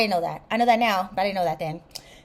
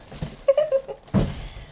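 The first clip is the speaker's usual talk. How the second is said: high breathy giggle. Real, not acted. Unwrapping the boots.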